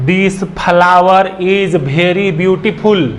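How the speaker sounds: slow, with "very" said as bhery and "flower" as phalawar; common wrong pronunciation. Retold or imitated. Teacher imitating how many people mispronounce it, slowly. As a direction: imitate the Hindi-speaker's "This flower is very beautiful" with an aspirated b. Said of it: "बहुत लोगों का जो tone है या जो pronunciation है वो कहते हैं".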